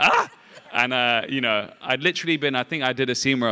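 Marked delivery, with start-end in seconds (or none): 0.0-0.3 loud, high excited shout of Ah; excited yelp. Retold or imitated. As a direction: let out a sudden thrilled yelp. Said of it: "And I was like".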